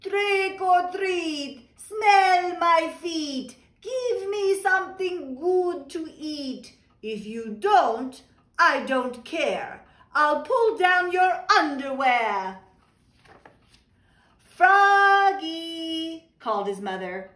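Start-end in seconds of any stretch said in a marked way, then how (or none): 0.0-12.6 high, slow, loud hammed-up vampire chant; a frog kid doing his best Dracula, slow and loud. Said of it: "said in his best Dracula voice". 14.4-16.3 calling out. Acted. Mother calls out to Froggy.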